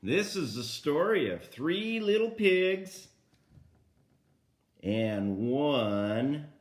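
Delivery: slow, emphatic storybook reading; reading to a toddler. Acted. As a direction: read each word clearly for small children. Reading to grandkids.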